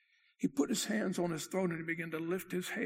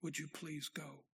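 soft, slow, calm request; 2 semitones lower, 9 dB quieter than usual. Retold or imitated. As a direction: ask it quietly, all agitation gone. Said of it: "In a very calm voice".

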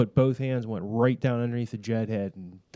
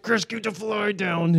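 mock urgent instruction; 8 semitones higher, slower than usual.

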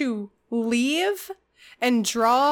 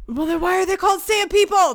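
indignant; 7 semitones higher, 4 dB louder, faster than usual. Real, not acted. Indignant at the odd name.

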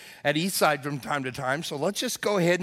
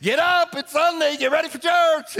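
high, loud nagging holler; yelling wife; 11 semitones higher, 4 dB louder than usual. Retold or imitated. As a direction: holler like a wife rousing her husband. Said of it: "yells at her husband".

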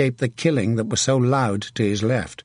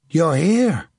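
higher, wondering relief; relief and wonderment; 5 semitones higher, 3 dB louder than usual. A narrator's reading. Hook to the saint's voice, relieved wonderment. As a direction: marvel aloud, relieved. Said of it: "he said aloud in relief and wonderment".

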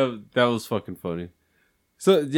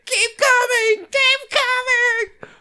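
much higher, louder, slow drawn-out yell; psycho shout; 3 dB louder, much slower than usual. Retold or imitated. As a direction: scream it at him like a crazed woman with a bat. Re-enacting the same crazed yell.

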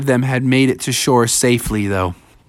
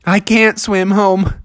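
higher-pitched storybook bear protesting; dismayed Bubby; 8 semitones higher than usual. A narrator's reading. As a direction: plead helplessly, pitched up. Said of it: "And Bubby said".

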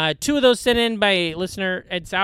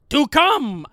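higher-pitched mock triumphant yell; imitated yelling; 7 semitones higher, 4 dB louder than usual. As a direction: yell it like a hyped-up hallway rant. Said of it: "he's in a hallway and he's yelling".

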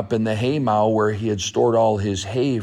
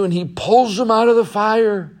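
ordinary delivery

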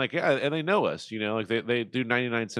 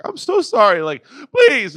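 much higher, louder wailing plea; mock crying apology; 11 semitones higher, 10 dB louder than usual. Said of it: "You're crying".

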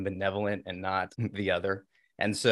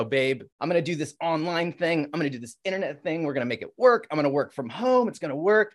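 higher-pitched, building in eagerness; eager pitch; 6 semitones higher, 4 dB louder than usual. Retold or imitated. Husband pitching an online business to wife, eager. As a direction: sell the plan with pushy optimism.

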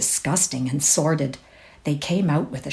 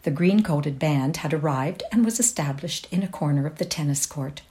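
ordinary delivery, nothing marked

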